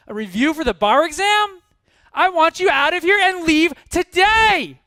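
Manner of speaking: high, full-volume tirade at an employee; furious boss yelling. Retold or imitated. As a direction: yell as the outraged boss, stretching key words. Boss explodes at departing employee.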